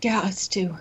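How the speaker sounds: hushed, whispered agreement; whispered reply. Acted. Player answering the healer's whispered word, whispering.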